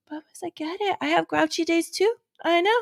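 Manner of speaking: put-on soothing child talk. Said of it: "it's like".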